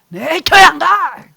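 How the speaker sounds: loud slow stubborn shout; defiant refusal. Acted. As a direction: dig in and yell no. Acted spouses quarreling over worship.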